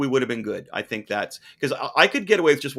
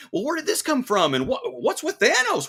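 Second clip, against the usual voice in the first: confused fan; 9 semitones higher than usual. Retold or imitated. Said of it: "mid-range Marvel enthusiasts are like".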